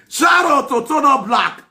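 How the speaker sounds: high, loud, slow teasing yell; teasing shout. Real, not acted. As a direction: taunt it slowly and loud.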